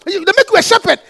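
usual speaking voice

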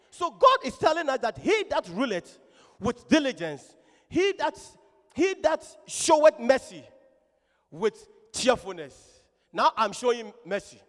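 loud scripture exposition; emphatic preaching. Real, not acted. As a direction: drive the verse home to the congregation.

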